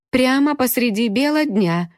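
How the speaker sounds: high mournful singsong wail; wailing lament. Acted. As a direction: wail it like an ancient keening mourner. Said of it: "выла психологичка ... Горестно и нараспев". (горестно, нараспев, с воем)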